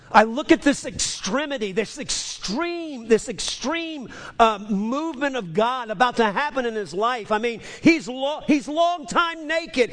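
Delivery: full-force preacher building intensity; preaching at full force. Real, not acted.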